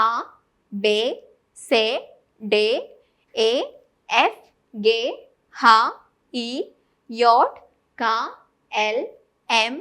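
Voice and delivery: ആ, ബേ, സേ, ഡേ said as German letter names, each one short and punched; German alphabet recited. Shown as a demonstration. Tutor reciting German letter sounds for learners to copy. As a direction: chant the German sounds ആ ബേ സേ ഡേ one at a time, loud and separate. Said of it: "ഞാൻ ഒന്നും കൂടെ alphabets പറയാം".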